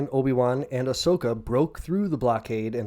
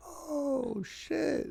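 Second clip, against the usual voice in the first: much higher, softer dismayed gasp; quiet dread; 8 dB quieter than usual. Retold or imitated. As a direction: breathe it as doom dawns. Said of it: "And he's like".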